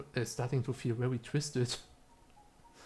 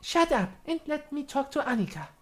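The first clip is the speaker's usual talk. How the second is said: high, snappy character voice cutting someone off; bossy character voice; 13 semitones higher than usual. Acted. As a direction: tell them to shut up in character. Character snaps at another.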